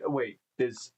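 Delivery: ordinary delivery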